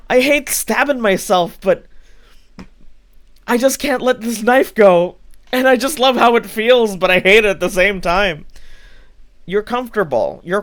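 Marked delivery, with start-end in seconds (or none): 0.1-1.6 "I hate stabbing myself" higher and louder in a whiny parody; lightly mocking whine; mock lament. Imitating the friend's self-pity. 1.6-8.4 higher, louder mock lament; lightly voiced whiny friend. Imitating the friend's self-pity.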